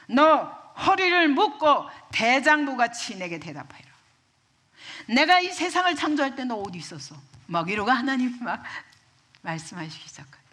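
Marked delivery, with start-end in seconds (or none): 0.1-7.1 thundering, raised voice of divine challenge; God. Acted. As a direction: confront him loudly, as if from the storm. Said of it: "팍 폭풍 가운데 나타나십니다". (폭풍 속에서 호령하듯 크고 높게)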